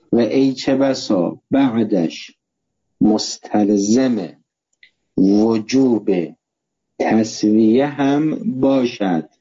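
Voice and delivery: steadier read-back with short pauses between phrases; dictation repeat. Real, not acted. Teacher repeating the sentence for note-takers, brisker measured dictation.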